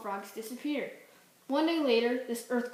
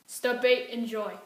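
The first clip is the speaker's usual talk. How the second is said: ad read; 7 dB louder than usual. Acted.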